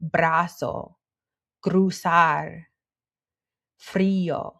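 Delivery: "brazo, cruzar, frío" with Spanish tongue-tip R; "brazo, cruzar, frío" pronounced carefully in Spanish; R after consonant. Shown as a demonstration. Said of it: "R is rolled when it comes after a consonant".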